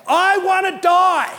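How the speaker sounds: loud, slow, despairing cry. Retold or imitated. Preacher voicing Elijah's negative confession, loud despairing cry. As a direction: cry out the death wish like Elijah.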